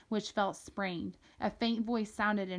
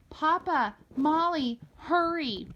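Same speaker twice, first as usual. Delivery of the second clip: urgent calling shout, high and loud; 8 semitones higher, 6 dB louder, slower than usual. A narrator's reading. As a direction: call out in alarm from afar. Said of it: "Molly heard a new voice".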